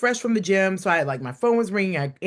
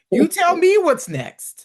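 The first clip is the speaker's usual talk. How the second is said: slow, loud and high, each word spelled out; hammed-up big reveal; mock announcement; 11 semitones higher, 7 dB louder, slower than usual. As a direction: make it a grand teaser.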